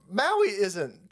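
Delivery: ordinary talk, nothing marked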